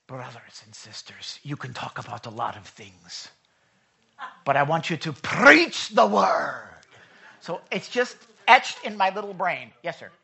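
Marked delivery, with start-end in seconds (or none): 0.1-3.3 lightly voiced low, soft Scottish preacher; Alistair Begg. Pastor imitating an evangelist's conference address, softly Scottish. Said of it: "Alistair Begg was the one". 4.4-6.7 booming, drawn-out Scottish preacher; Alistair Begg. Retold or imitated. Pastor imitating a conference preacher's charge, booming Scottish accent. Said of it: "that's why I say it like that".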